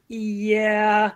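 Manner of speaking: drawn-out hesitant yeah; stretched-out yeah. Shown as a demonstration. Teacher demoing a warning sign, stretched yeah. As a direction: let the yeah hang. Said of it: "that kind of stretched out".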